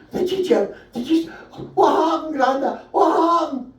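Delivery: put-on breathless child begging for the war story; eager kid voice. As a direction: squeak it out like an excited wee kid. Comic voicing young self to granda.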